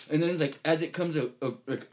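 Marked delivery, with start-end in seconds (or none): none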